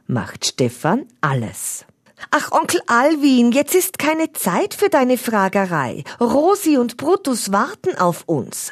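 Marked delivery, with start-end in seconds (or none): 2.3-8.7 high, impatient brush-off; challenging Finn. A narrator's reading. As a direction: push the uncle to move. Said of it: "schaut herausfordernd an".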